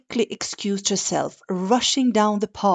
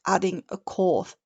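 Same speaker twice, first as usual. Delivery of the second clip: ordinary delivery, nothing marked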